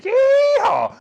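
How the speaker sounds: huge high yeehaw shout; loud cowboy yell of triumph; cowboy whoop. Acted. Cheers at the landing.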